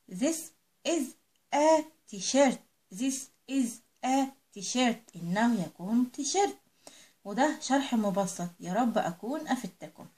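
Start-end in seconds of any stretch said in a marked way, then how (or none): 2.9-5.2 slow model sentence. Shown as a demonstration. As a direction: say This is a t-shirt word by word, very slow. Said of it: "هنعمل مقص".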